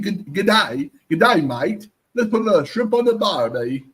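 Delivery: lightly hammed-up Australian accent; mock Australian accent. Teasing Siri's new Australian voice, mock Australian accent. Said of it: "my Australian friend would kill me".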